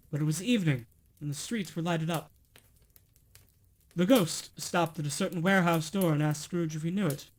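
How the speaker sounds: plain reading voice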